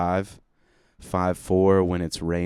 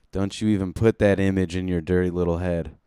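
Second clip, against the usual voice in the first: ordinary delivery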